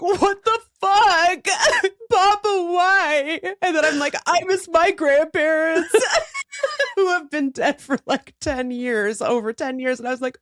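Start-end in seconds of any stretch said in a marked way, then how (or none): 0.0-3.4 high, slow laughing sob; half-laughing wail at Papa; mock sobbing. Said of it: "I'm in bed like". 4.3-10.0 high, teary laugh-cry. Retold or imitated. Retelling crying over TikTok.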